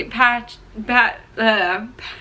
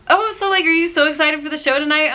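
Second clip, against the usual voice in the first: much higher, quick, bubbly caller; Sierra; 8 semitones higher, faster than usual. Retold or imitated. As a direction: imitate an eager caller, high and quick. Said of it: "Sierra was like".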